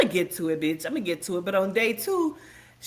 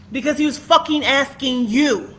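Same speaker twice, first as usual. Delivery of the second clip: loud, higher, heated; angry shout; 4 semitones higher, 6 dB louder than usual. Real, not acted. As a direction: snap it back out loud. Angry rant resumes.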